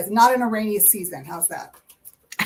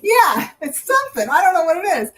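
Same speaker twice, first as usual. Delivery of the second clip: excited; 7 semitones higher, 7 dB louder than usual. Real, not acted. Excited about the reading.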